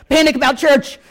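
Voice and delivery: "Panic" shouted high and loud; mock panic cry. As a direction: yell "Panic about church!" in mock alarm. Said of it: "I'm like".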